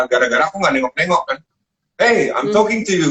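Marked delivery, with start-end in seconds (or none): 2.0-3.1 higher, slower mock boss scolding; imitating an irritated Italian head chef. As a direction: play the annoyed boss, drawn out and pitched up. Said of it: "kata dia".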